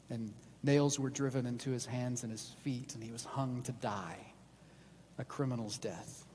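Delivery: low, hushed, with heavy pauses; solemn sermon storytelling. A narrator's reading. Preacher recounting the crucifixion to a congregation, solemn and subdued. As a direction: let each grave event sink in softly.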